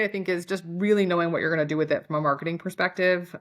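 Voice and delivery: ordinary delivery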